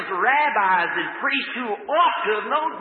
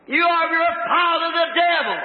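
high, loud accusation; thundering denunciation; 10 semitones higher, 4 dB louder than usual. Acted. Jesus rebuking scholars and priests, thundering denunciation. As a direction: denounce them at raised pitch and volume.

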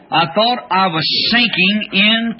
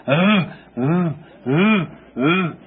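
low moaning groans like lost souls; imitating the moans of the damned; mournful moaning; 4 semitones lower, slower than usual. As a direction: moan low and hollow, like haunted women. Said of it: "they were going".

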